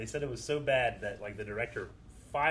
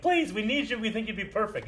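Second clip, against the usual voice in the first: high-pitched imitation of begging; playful wheedling quote; mock pleading; 8 semitones higher, 3 dB louder than usual. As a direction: plead eagerly, acting out the producers. Retelling producers begging the actor.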